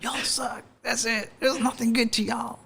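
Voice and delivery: high, hammed-up Southern accent. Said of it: "like, a Southern accent".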